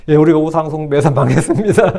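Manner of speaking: low-pitched answer breaking into laughter; laughing mock confession. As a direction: confess in jest, laughing through it. Acts an absurd answer, laughing.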